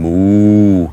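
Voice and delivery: a loud, drawn-out cow moo; mock cow moo. Reading a chat cow joke.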